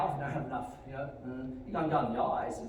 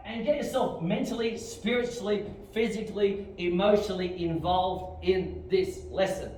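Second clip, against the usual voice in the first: raised, high instructor voice; rousing exhortation; 5 semitones higher, 5 dB louder, slower than usual. Real, not acted. Instructor urging students to commit to lesson, loud and emphatic. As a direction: drive each word home to the whole class.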